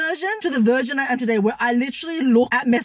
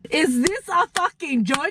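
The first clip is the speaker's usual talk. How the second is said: slow, higher, drawn-out incredulous repeat; retold disbelief; 4 semitones higher, slower than usual. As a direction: stretch each word, demanding an answer from the sky. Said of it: "looked up to the sky and I was like".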